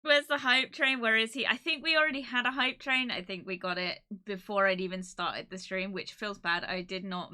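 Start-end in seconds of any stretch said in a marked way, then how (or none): none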